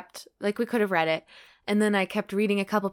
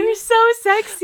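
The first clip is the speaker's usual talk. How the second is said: loud high swoon; 14 semitones higher, 10 dB louder than usual. Real, not acted. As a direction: swoon over it loudly. Swooning echo.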